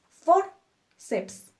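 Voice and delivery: "Forceps" split, high stressed FOR, then ceps; stressed penultimate syllable. Shown as a demonstration. Teacher accenting the penultimate syllable, "Forceps" split with FOR stressed. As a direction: hit FOR high in "Forceps", pause, drop on ceps. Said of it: "acentuamos nuestra penúltima sílaba".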